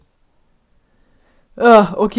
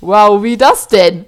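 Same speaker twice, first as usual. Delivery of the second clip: amazed; 8 dB louder, slower than usual. Acted. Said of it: "Die starrte mich an".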